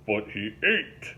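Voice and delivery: slow, heavy country drawl; slow Southern boatman; swamp boatman voice. Acted. Link indignant insisting.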